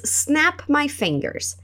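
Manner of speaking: very slow and a bit soft; drawn out so learners can copy; slow teaching read; slow model phrase. Shown as a demonstration.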